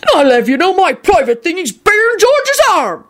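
high, indignant boasting; furious shout. A narrator's reading. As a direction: insist on it in outrage. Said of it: "Hagrid shouted furiously".

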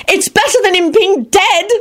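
high, shrill frantic cry; frantic screech. A narrator's reading. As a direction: screech it in panic. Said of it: "Elizabeth screeched frantically".

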